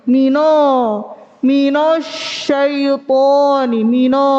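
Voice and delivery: MINO with a heavy, rounded n turning into "no"; thickened nun. Shown as a demonstration. Teacher showing a student mistake, nun thickened. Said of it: "Menebalkan huruf nun".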